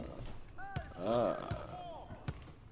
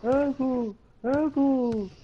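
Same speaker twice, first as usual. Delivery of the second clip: mock-hurt whining; 9 semitones higher than usual. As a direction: wail it high like a sore loser. Playful complaint after losing.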